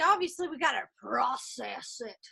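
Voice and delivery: usual speaking voice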